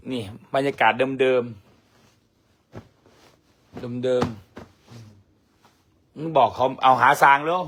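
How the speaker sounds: stretched, very slow words; drawn out. Pretend. Teasing the viewers, slow drawn-out words. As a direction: drag every syllable out.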